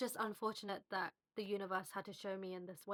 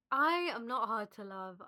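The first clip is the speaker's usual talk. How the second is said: louder, pushing through tears; emotional; 2 semitones higher, 7 dB louder than usual. Real, not acted. To her viewers, repeating her breakup lesson, louder and near tears. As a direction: declare it while tearful. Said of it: "I don't want to get emotional".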